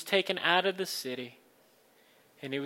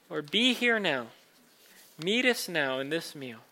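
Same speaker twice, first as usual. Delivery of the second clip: fervent pastor's prayer; 7 dB louder than usual. Acted. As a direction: pray it with rising fervour. Pastor leads communion prayer.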